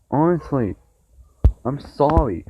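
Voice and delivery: ordinary talk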